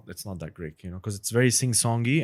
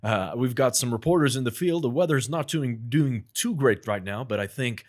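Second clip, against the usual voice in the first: news anchor; 3 semitones higher, 4 dB louder than usual. Pretend. Asked by a friend to do a news anchor voice. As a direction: announce it like a TV newsreader. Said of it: "let's hear news anchor".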